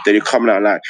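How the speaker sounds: ordinary talk, nothing marked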